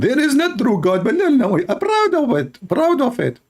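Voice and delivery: very high mocking squeal; hammed-up high-pitched mimicry; Liya Dawa. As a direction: put on a comically high voice. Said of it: "This is the voice of Liya Dawa".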